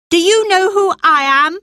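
put-on high, loud, fast imperious boy; haughty demand. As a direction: demand it like a little prince. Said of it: "the boy demanded".

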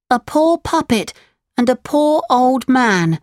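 put-on very high puppet boy voice; Pinocchio, pitiful. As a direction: answer as a poor little puppet. Pinocchio answers unseen voice.

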